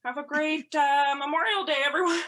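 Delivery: high, loud over-cheery send-off; loud farewell. Retold or imitated. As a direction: call out goodbye to the whole crowd. Awkward farewell to stunned crowd.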